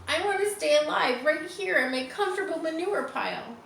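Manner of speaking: high, mild weepy plea; a crying piglet; tearful pleading. A narrator's reading. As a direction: wish aloud through tears. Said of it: "Wilbur burst into tears".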